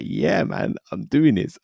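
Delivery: high-pitched, pleased with himself; retold excitement. Telling of his pay rise, retold excitement. Retells delight at higher pay.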